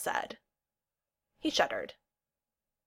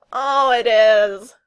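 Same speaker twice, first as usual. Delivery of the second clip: long, loud anguished groan; 4 semitones higher, 15 dB louder, much slower than usual. A narrator's reading. Neil clutches his chest over Kel's lover, groaning. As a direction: draw it out slowly in theatrical dismay. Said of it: "Neil groaned".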